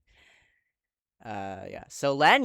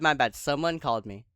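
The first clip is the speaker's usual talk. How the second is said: ordinary talk, nothing marked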